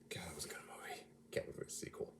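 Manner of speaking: soft unvoiced whisper; breathy hushed aside. Real, not acted. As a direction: whisper it like a secret to the mic. Quiet aside about the movie.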